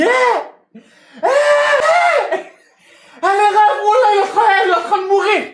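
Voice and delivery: high frantic yell for help; panicked shout. Retold or imitated. Yelling to bystanders that she is dying, frantic shout. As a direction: scream it out to the crowd around you.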